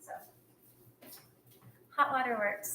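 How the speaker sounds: usual speaking voice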